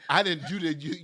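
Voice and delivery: ordinary talk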